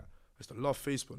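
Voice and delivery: normal talking voice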